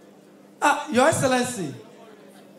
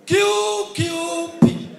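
very slow, louder, each letter spelled out; drawn-out announcement; 5 semitones higher, 5 dB louder, much slower than usual. Acted. Announcing uncle's party slowly.

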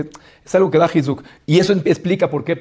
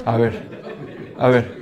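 chuckled a ver, lower; laughing; 4 semitones lower, 4 dB quieter, slower than usual. Retold or imitated. King laughs at his advisor's clever answer. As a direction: laugh it off, won over.

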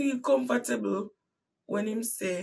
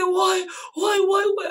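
high, loud desperate why; anguished cry; 11 semitones higher, 9 dB louder than usual. Retold or imitated. Bleeding in pain at her mother's house, crying out loud and high. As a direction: cry out to the heavens.

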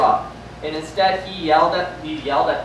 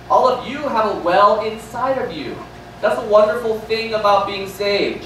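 usual speaking voice

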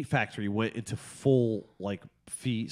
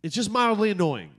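high, emphatic frustration; exasperated rant; 5 semitones higher than usual. Real, not acted. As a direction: hammer each short sentence in irritation. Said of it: "nicotine-deprived hate fest".

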